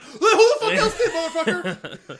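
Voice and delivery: high-pitched comic outrage, last word drawn out; mock-furious high-pitched retelling; exasperated retort. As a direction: squeal it incredulously through laughter. Retelling the same exasperated argument.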